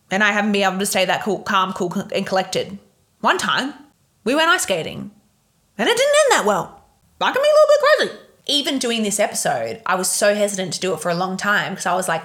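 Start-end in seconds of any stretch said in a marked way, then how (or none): 5.8-8.2 high, fast hammed-up squeal; mock crazy voice. Real, not acted. Confessing to listeners she can be crazy, hammed-up high squeal. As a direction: play up your own craziness. Admitting a bad fight, laughing.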